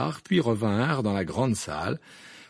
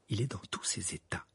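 much softer, hushed worry; low voice; 9 dB quieter than usual. A narrator's reading. Mrs Weasley confiding about Percy, hushed. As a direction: say it under your breath. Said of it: "dit Madame Wesley à voix basse".

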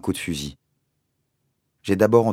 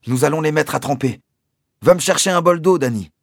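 cheerful father; 9 semitones higher, 7 dB louder than usual. A narrator's reading.

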